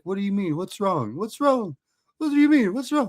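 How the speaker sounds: high-pitched imitated concern; sweet, concerned friend. Sweet Terry asking the upset wrestler, high mock concern. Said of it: "who is the sweetest man here".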